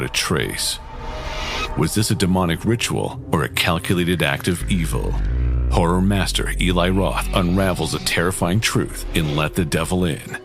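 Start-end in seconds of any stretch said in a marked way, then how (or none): none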